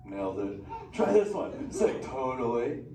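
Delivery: laid-back surfer dude turtle, stoked. Acted. Turtle Crush greets the humans in the tank, surfer voice.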